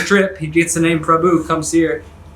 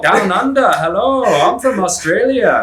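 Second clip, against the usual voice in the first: put-on high, drawn-out caricature Australian accent; hammed-up singsong Aussie accent; mock Australian accent; 6 semitones higher, slower than usual. Locals imitating how Australians sound, exaggerated Australian accent. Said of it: "Australians sound hilarious".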